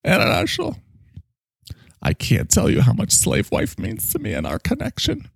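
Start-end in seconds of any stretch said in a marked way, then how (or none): 0.0-0.8 high put-on voice. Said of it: "My soulmate sister".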